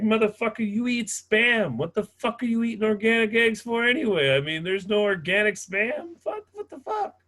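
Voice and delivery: loud, high, slowed-down mock outrage; mock rant. Cashier ranting at a customer about organic eggs, mock outrage. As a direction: rant back at him, hammed-up. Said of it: "And I was like".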